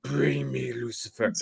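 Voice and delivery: drawn out softly like an ominous caption; Artesia. Retold or imitated. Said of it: "give me Artesia doing this".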